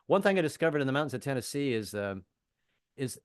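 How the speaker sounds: ordinary talk, nothing marked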